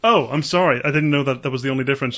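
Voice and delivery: normal talking voice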